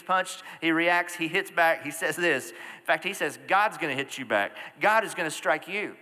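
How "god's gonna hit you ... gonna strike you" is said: raised, louder mock retort; trash talking. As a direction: hit back with heated words. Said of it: "That's New Testament trash talking".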